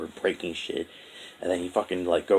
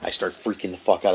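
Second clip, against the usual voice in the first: normal talking voice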